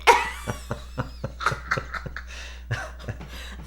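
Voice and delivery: soft, wheezy laughing fit; long helpless laugh. Real, not acted. Wife cracks up at rhymes with chicken, wheezing laugh. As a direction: laugh until the voice gives out.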